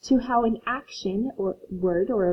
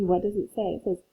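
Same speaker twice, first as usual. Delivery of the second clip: normal talking voice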